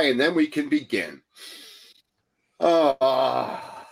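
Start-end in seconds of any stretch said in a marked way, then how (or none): 2.6-3.9 sigh. Shown as a demonstration. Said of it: "exhale out through the mouth with a sigh".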